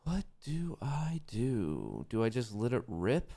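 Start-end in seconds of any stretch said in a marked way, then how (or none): 0.0-2.1 slow, breathy whispered question; whispered worry. Real, not acted. Alone at the screen, a slow whispered worry. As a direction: whisper it to yourself, slowly.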